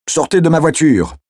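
high-pitched, agitated taxi driver; angry order. Acted. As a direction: order him out, pitched well up. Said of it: "ordonna-t-il à Matthew".

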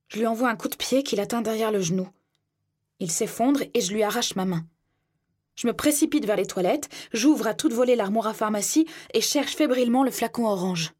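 plain reading voice

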